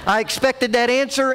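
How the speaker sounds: usual speaking voice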